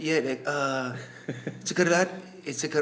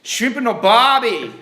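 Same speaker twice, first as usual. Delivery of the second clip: mock Australian; 4 semitones higher, 7 dB louder, slower than usual. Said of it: "that's all people say in Australia".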